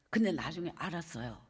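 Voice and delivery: ordinary delivery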